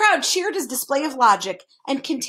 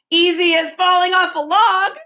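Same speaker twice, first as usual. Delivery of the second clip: high, loud exclamation; ringing cry from the Earl; 7 semitones higher, 6 dB louder than usual. A narrator's reading. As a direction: call it out breezily, pitched up. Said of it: "cried the Earl".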